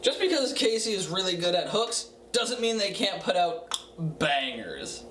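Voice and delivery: usual speaking voice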